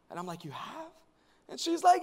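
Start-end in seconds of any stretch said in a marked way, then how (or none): 0.4-0.9 small, soft questioning reply; puzzled. Retold or imitated. Pastor answers eager stranger, quiet bewilderment. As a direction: ask it softly, taken aback. His baffled reply to admirer.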